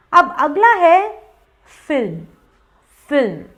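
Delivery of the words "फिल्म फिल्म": फिल्म as one syllable; short falling फिल्म; correct pronunciation. Shown as a demonstration. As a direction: say फिल्म as "film", l and m joined. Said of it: "उसका correct pronunciation है फिल्म".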